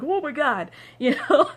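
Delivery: high, loud oh my God with a laugh; shocked as the bus lurches; startled. Retold or imitated. Watching the bus jump the curb, startled and laughing.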